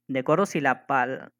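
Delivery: normal talking voice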